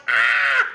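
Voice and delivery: a short loud frustrated "Ah!"; mock frazzled yell. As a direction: blurt out a fed-up shout. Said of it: "Mama, you're like".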